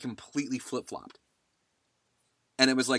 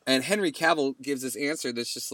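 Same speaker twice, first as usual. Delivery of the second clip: normal talking voice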